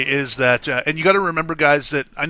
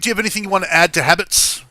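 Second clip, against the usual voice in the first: usual speaking voice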